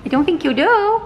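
normal talking voice

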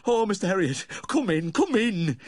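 high, loud tearful welcome; brokenly crying. A narrator's reading. Weeping owner letting the vet in, brokenly. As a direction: cry it out, drawing out the last words. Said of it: "he cried brokenly".

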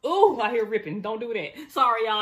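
loud, sharp alarm. Real, not acted. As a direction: cry out in alarm at the rip. Alarm at the box ripping.

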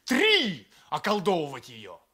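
furious high shout; angry shout. Acted. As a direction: throw the number in his face. Heated quarrel between two men.

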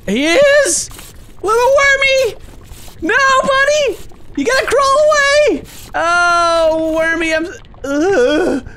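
very high, squealing anguish; hammed-up heartbroken panic; distraught wailing. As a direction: cry out to a doomed little friend. Finds worm inside the food.

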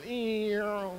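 drawn-out zooming sound effect; flying board noise. Retold or imitated. Board flying toward the audience, imitated whoosh. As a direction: whine like something sailing off. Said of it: "That board went flying".

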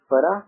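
"Farah" offered as a one-word query; questioning. A narrator's reading. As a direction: ask "Farah" tentatively, with a questioning lift. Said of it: "سوالیہ انداز میں کہا".

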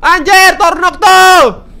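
loud, high panicked shout; scared yell. Real, not acted. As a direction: yell as the monster appears. Monster appears. (teriakan panik yang keras)

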